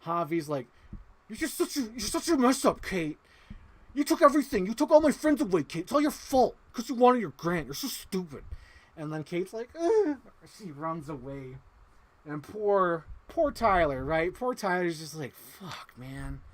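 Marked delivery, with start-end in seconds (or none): 1.3-8.4 high-pitched, hammed-up whiny blame; mock angry outburst. Javi blames Kate for losing his friends, mock angry. 9.8-10.2 loud, high-pitched mock groan; exasperated ugh. Kate reacts to Javi blaming her, exasperated groan. Kate's reaction to being blamed. 15.3-16.4 mock dejection. Tyler's dejected reaction, retold.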